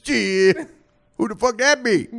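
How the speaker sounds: high, loud mock disbelief; imitated loud woman. Clerk hears of a huge salary, imitated loud disbelief. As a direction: blurt it out as the incredulous woman. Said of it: "She goes".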